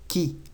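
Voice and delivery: qui said as a clear ki; French qui. Shown as a demonstration. Teacher models qui for Spanish speakers. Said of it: "Se dice qui".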